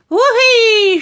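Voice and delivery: put-on shrieking old hag, very high and loud; shouted whoopee. A narrator's reading. Grandma to George, gleeful shriek. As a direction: let out a wild whoop of joy. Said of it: "she shouted".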